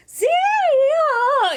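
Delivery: high loud warm-up call; vocal warm-up. Shown as a demonstration. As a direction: belt it out like a vocal exercise. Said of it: "you'll hear me doing my".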